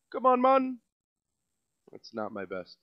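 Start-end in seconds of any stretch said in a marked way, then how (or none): 0.1-0.8 put-on Rastafarian accent. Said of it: "Ras Zafarian voice".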